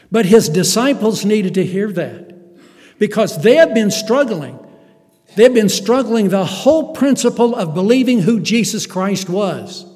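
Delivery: loud, high preaching build; full-force sermon climax; fiery preaching. Acted. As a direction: preach it with rising fire.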